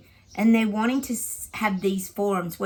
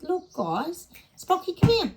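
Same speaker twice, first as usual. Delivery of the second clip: high, sing-song call to a dog; high coaxing call; 10 semitones higher than usual. Real, not acted. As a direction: coax the dog over, high. Calling the dog.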